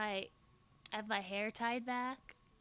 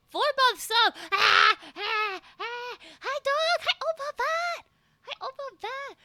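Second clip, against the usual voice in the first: high, loud excited greeting; excited squeal; 11 semitones higher, 11 dB louder than usual. Real, not acted. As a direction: squeal hello to chat.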